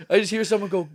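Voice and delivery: ordinary delivery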